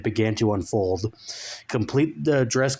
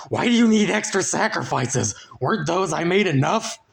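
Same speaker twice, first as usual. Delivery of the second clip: higher, fearful put-on voice; trembling plea; 8 semitones higher than usual. Frank quivering before the Dark Lord. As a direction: ask with a quiver of fear. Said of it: "quivering with trepidation".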